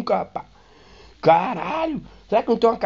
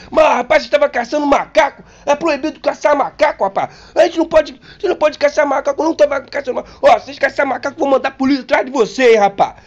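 higher, louder indignant doctor; scolding doctor; 10 semitones higher, 7 dB louder than usual. Retold or imitated. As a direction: scold them like an outraged doctor. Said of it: "o médico".

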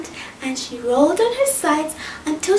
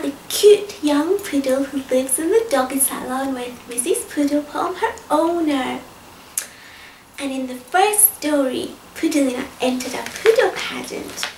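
plain reading voice

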